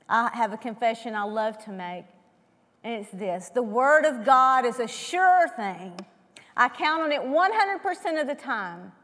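ordinary delivery

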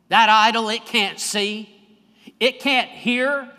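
slowed, emphatic pulpit crescendo; fervent preaching. Acted. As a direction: build the sermon to a fevered peak. Preaching builds to climax.

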